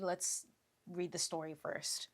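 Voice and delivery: ordinary talk, nothing marked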